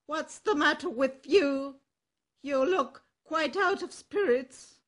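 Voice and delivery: loud, bold donkey voice; a confident ass calling out to a friend; hearty donkey. Acted. Ass addresses the cat.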